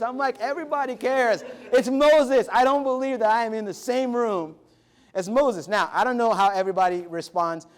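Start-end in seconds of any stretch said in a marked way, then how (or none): 0.4-2.5 higher and louder, almost indignant; excited insistence. Retold or imitated. Insisting to his friend John, excitedly. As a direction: insist it's a big deal.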